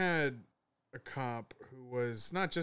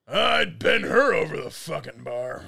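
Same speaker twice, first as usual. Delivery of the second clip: put-on leering old man; 4 semitones higher, 11 dB louder, slower than usual. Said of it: "Find one and go".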